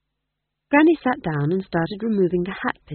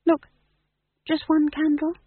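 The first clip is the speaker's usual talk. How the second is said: very high, small plea; high-voiced pleading question; 13 semitones higher, 4 dB louder than usual. A narrator's reading. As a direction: beg a little favour, pitched high. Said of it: "a look of pleading in her eyes".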